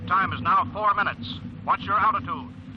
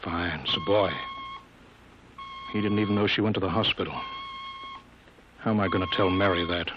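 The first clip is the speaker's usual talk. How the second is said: subdued grief; 6 semitones lower, 3 dB quieter than usual. Acted. Chief grieving lost pilot.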